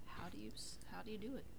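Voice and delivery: hushed, breathless wondering; soft unvoiced musing; whispered question. Real, not acted. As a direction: whisper it like a secret puzzle. Puzzling over impossible accent.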